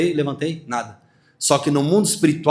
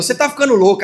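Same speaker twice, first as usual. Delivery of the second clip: dismissive crowd; 7 semitones higher, faster than usual. Acted. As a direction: brush her off.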